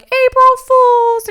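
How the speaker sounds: April Fools yelled loud and high, drawn out; spring the prank with a loud slow cheer; gleeful shout. Retold or imitated. Teens to gullible dad on phone, triumphant shout.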